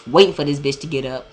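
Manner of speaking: ordinary talk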